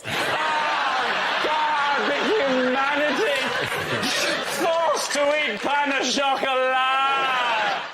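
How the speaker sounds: high, slow hammed-up lament; theatrical despair shout; mock tragic outcry. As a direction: cry it as if mourning a catastrophe. Mock outrage at croissant shortage.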